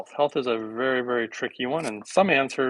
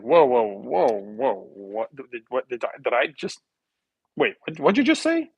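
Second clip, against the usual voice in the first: imitating a doctor who can't believe her ears; stunned disbelief.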